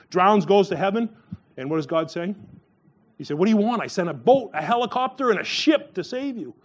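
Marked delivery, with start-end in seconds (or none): 3.4-6.5 high-pitched, incredulous comeback; exasperated retort. Retold or imitated. God to the drowned man in heaven, exasperated retort. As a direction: count off each rescue in mock exasperation.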